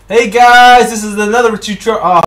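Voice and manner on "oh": ordinary talk, nothing marked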